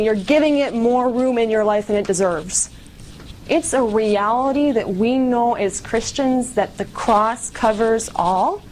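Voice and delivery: ordinary delivery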